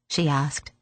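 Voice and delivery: ordinary delivery, nothing marked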